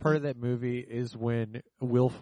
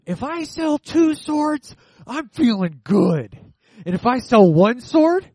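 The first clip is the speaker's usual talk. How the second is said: Will Forte impression; 11 semitones higher, 15 dB louder than usual. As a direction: belt it like a proud salesman. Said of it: "he's just like".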